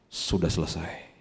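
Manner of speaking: low, slow, hushed last words; solemn hush. Acted. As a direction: let it fall quietly, like a final breath. Christ's last words, solemn quote.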